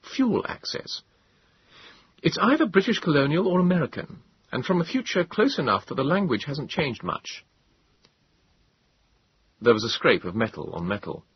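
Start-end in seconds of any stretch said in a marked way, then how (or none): 0.0-7.4 higher voice, curious gentleman; a gentleman puzzling it out; Moses voice. A narrator's reading.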